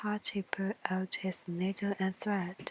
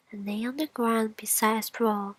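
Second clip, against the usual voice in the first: ordinary delivery, nothing marked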